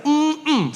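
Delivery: showy self-satisfied hum; cocky hum. Retold or imitated. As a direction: hum like you own the place. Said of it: "I was like".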